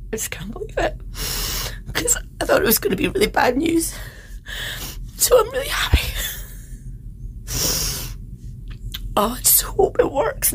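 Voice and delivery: tears of relief spilling over as she speaks; happy crying. Real, not acted. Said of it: "So I know I am crying".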